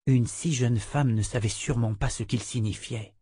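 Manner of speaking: usual speaking voice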